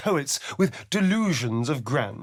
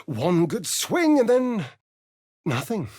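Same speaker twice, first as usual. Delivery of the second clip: higher and louder, savouring the swing; gleeful outburst; 5 semitones higher, 3 dB louder than usual. Acted. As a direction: bark it out with relish. Relishing a violent thought.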